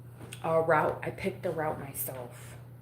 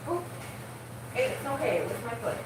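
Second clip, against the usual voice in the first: soothing pet; 5 semitones higher than usual. Real, not acted. Soothing pet after stepping on it.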